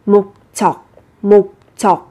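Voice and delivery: 목적 as mok-jjeok, tense doubled jj; doubled consonant. Shown as a demonstration. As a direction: separate 목적 into mok, then a hard jjeok. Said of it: "đọc gấp đôi phụ âm sẽ là".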